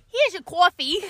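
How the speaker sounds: light Boston "coffee"; mock Boston accent on "coffee"; imitating a Boston accent. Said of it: "She said".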